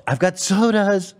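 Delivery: high, drawn-out mock excited call; excited roommate. Friend reviving the college running joke, hammed-up excitement. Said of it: "and he's all excited".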